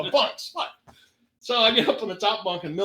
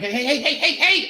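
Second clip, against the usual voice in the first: rapid-fire heys climbing higher and louder; alarmed protest; 9 semitones higher, 4 dB louder than usual. Acted. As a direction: halt someone with rising, mock-panicked calls. Acted protest at being patted down.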